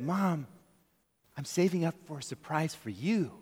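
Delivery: put-on soft, hushed child's confession; sweet little boy. Son softly tells mom the surprise is for her.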